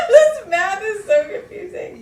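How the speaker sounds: high loud laughing complaint. Real, not acted. Laughing confusion at the math.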